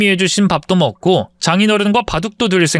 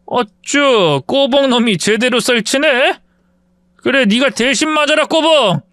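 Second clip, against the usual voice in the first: higher, mocking jeer rising to a shout; taunting bully; 8 semitones higher than usual. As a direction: taunt him before the fight. Bully taunts before fistfight.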